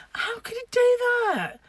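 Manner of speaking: high-pitched comic indignation; mock disbelief. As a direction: squeal it in exaggerated dismay. Mock outrage at crawling mouse.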